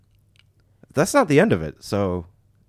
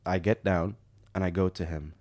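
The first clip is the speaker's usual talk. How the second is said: usual speaking voice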